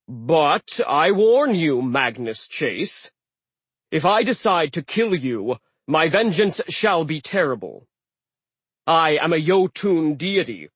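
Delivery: slow, booming threat; threatening sea god. Acted. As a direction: warn them with primordial menace. Aegir's threat.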